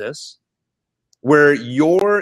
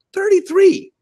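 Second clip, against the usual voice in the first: amazed emphasis; 4 dB louder, slower than usual. Real, not acted. Marvelling at his young age.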